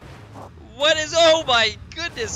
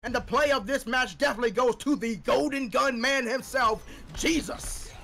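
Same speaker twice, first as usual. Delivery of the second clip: fast esports commentator voice; sports caster. Pretend. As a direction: call it like a caster. Mock caster play of the game.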